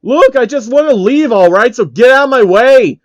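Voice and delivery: loud, high, exasperated yell; fed-up loud outburst; angry Phil. Acted. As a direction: shout him out of the way. Phil voiced angrily in story.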